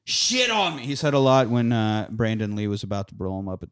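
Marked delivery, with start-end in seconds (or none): 0.0-0.8 higher mock quote. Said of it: "Gideon's line of".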